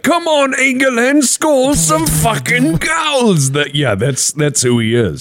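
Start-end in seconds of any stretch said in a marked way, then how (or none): 0.0-3.5 much higher, slow mock football chant; imitating Chippy. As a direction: belt it out like a fan yelling at the telly. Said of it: "He's like".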